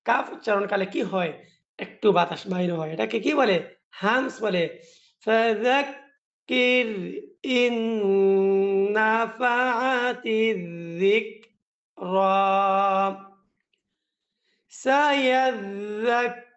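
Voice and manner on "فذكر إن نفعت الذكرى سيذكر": measured, drawn-out tajweed chant; teacher recites each word slowly for students; slow Quran recitation. Shown as a demonstration. Teacher reciting to students, in slow drawn-out tajweed chant.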